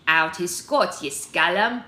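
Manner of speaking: loud, scoffing Scottish figurehead; Scottish accent. A narrator's reading. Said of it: "okay, so it's supposed to be Scottish".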